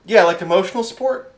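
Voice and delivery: high, loud, helpful bureaucrat; clarify like an earnest pencil-pusher; high-pitched official checking politely; pencil-pushing clipboard official. Acted. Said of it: "pencil-pushing motherfucker with a clipboard".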